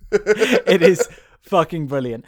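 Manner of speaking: high, loud laughing delivery. Real, not acted. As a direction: say it through a burst of laughter. Laughs recalling the prank video.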